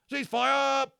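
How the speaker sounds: high, loud bawled order; the sergeant major bawling the ceasefire; bawled command. A narrator's reading. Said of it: "bawled Fickets".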